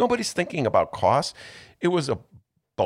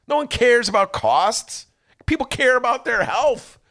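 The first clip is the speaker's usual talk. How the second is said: higher, pressing indignant rant; heated rant; 11 semitones higher than usual. Real, not acted. Host railing at Joe Biden over costs, indignant. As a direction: hammer the outrage home. Ranting against Biden's cost argument.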